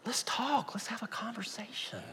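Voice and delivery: soft whispered "Let's have a conversation"; gentle hushed invitation; whispered invite. Real, not acted. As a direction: drop to a hushed plea.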